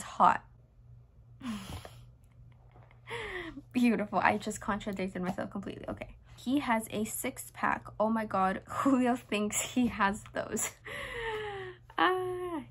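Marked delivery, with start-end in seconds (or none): 1.4-1.9 short soft laugh; embarrassed laugh. Real, not acted. 10.8-11.7 soft high-pitched giggle; high embarrassed laugh. Real, not acted. Laughing at diary entry.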